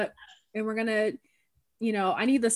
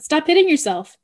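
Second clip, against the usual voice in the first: high, loud sibling taunt; teasing taunt; 8 semitones higher, 7 dB louder than usual. Retold or imitated. Sister mocking her sister in a slap fight, high and loud. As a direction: needle your sister mid slap fight. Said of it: "and I said".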